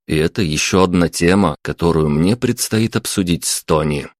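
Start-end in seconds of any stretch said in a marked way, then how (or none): none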